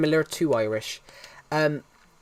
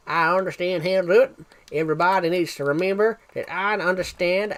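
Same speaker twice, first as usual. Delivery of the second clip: put-on Texan accent with a lilting pitch; 2 semitones higher than usual. Shown as a demonstration. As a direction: do a British streamer's Texan impression. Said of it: "I can do a Texan accent".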